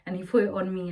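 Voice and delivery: ordinary talk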